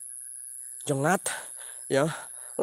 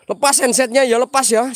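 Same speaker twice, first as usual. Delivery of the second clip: higher, louder urgent call; loud warning; 5 semitones higher, 6 dB louder than usual. Real, not acted. Streamer warning viewers to take off headphones, loud urgent call. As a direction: warn them loudly before the scare.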